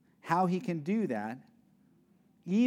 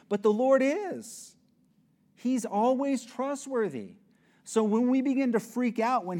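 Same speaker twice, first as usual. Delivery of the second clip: a preacher proclaiming, pitched high and louder; preaching emphasis; 9 semitones higher, 5 dB louder than usual. Real, not acted. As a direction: proclaim it to the room. Preaching climax.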